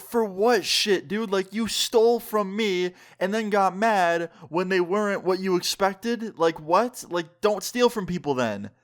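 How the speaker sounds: indignant retort. Retold or imitated. Storyteller replays arguing with the man who stole from him, indignant. Acted quarrel, protesting to the thief.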